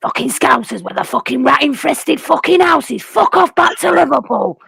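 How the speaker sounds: lightly mimicked deep rant, ending in a slow shout; imitating a bitter fan, deep and ranting; deep-voiced impression of him. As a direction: do his voice, building to a slowed shouted send-off. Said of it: "he talks very, um, deep".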